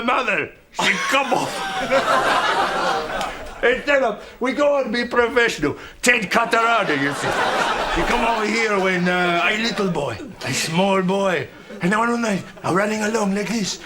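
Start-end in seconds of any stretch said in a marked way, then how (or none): none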